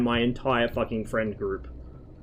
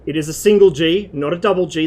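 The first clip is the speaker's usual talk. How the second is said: "single" punched louder, "double" answering it; stress on single; 4 semitones higher, 8 dB louder than usual. Shown as a demonstration. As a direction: hit "single" and then "double" hard. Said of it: "That's an important distinction to make".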